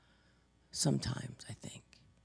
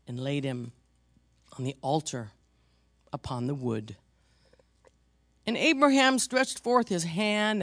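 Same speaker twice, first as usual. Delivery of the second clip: scripture reading; 4 semitones lower than usual. Acted. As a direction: read the passage solemnly. Reads Genesis scripture aloud.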